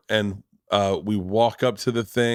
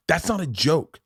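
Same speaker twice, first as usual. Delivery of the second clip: higher-pitched mock scolding; berating; 6 semitones higher than usual. Mother to daughter over a joke, berating. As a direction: imitate an indignant mother laying into a child. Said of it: "berated her".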